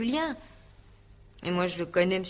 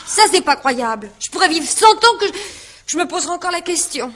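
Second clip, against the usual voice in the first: exasperated disbelief; 7 semitones higher, 8 dB louder than usual. Acted. Indignant witness insists on innocence.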